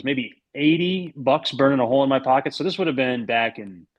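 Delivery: ordinary talk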